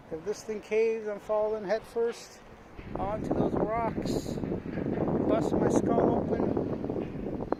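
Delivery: slow, drawn-out dread, breath running out at the end; nervous fear. Real, not acted. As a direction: spell out the fall slowly like you can already see it. Imagining falling onto rocks.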